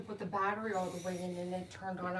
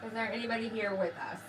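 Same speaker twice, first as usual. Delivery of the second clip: calling out. Acted. Calling out to spirits.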